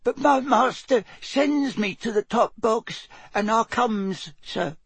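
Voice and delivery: slow, high, dutiful elf voice; nervous elf squeak. A narrator's reading. House elf afraid of heights obeys master, nervous squeak. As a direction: squeak it nervously but obediently. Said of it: "glanced toward the edge of the box and gulped".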